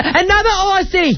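high falsetto caricature; mock red-carpet reporter. Mimicking a reporter greeting another star, in a high mock voice. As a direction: squeal it as a mocking impersonation. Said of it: "he was like".

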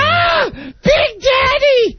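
high, slow shouted cry; over-the-top shout. Retold or imitated. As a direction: scream it like a parody climax. Parodying the moan.

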